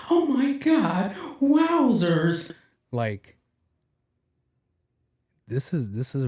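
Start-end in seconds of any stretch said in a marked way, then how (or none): 0.1-2.5 drawn-out falsetto mock amazement; TikTok gamer kid. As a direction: imitate a wowed kid, very high and slow. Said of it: "He was talking like this".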